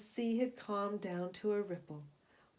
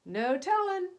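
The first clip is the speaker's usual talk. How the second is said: higher, louder salty captain; captain's loud exclamation; 9 semitones higher, 8 dB louder than usual. A narrator's reading. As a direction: toss it off like an old skipper. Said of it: "said the captain".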